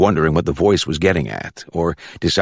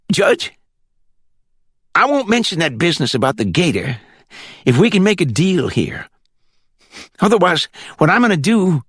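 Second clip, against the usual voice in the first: put-on country accent from the Glades; 12 semitones higher than usual. A narrator's reading. Caller on speakerphone checking for the judge, high country accent. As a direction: ask it in a high rural Florida accent. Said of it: "With that country accent".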